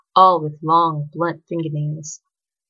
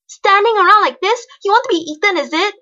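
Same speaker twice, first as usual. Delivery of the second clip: loud, high, cross questioning; exasperated scolding; 8 dB louder than usual. A narrator's reading. Girl scolding boy for standing near monsters, loud and exasperated. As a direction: scold loudly, voice pitched way up.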